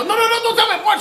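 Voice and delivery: hammed-up parent refusing the word bored; shrill mock parent. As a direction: squeal it like a scandalised parent. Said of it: "They go".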